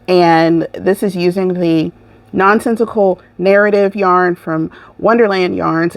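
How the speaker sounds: ordinary delivery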